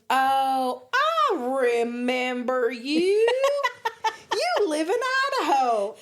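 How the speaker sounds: hammed-up Southern drawl breaking into laughter; thick Southern drawl. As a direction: imitate a Southern boy, stretching every vowel. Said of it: "just the thickest southern drawl".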